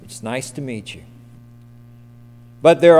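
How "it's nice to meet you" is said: quiet, polite formal greeting; soft respectful address. Retold or imitated. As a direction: say it with deference. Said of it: "very respectfully say".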